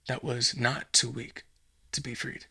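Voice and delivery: ordinary talk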